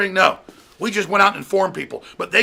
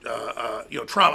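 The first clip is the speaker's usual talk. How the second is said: ordinary talk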